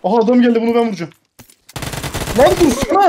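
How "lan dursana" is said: high, loud stop cry; sharp yell. Real, not acted. Husband yells at an enemy player to stop. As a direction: bark it at the enemy.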